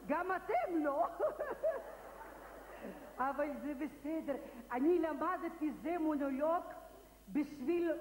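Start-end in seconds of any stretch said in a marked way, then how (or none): 1.1-3.2 high laugh trailing away; mock laugh. [name] teasing the audience for not understanding, high laugh. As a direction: burst of high, airy laughter.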